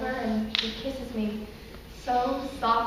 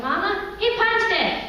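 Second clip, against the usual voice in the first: put-on child's protest; 9 semitones higher, 10 dB louder, slower than usual. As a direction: rise into a high, indignant plea. Child protesting to mama in monologue.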